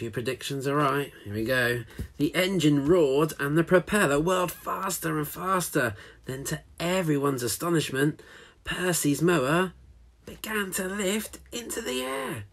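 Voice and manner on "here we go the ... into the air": lively read-aloud storytelling voice; story narration. A narrator's reading. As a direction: read it like a picture book to children.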